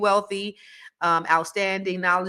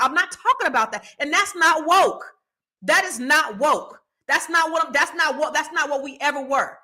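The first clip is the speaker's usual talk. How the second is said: heated, higher and fast, fed up; exasperated, raised-pitch rant at commenters; angry rant; 5 semitones higher than usual. Real, not acted. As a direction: tear into them for missing the point.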